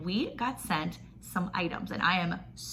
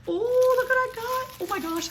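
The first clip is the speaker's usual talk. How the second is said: excited squeal. Real, not acted. Opening her sponsor box to viewers, squealing excitedly.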